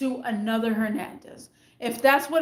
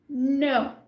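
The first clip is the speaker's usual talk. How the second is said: ordinary delivery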